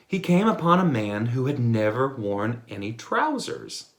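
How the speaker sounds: ordinary delivery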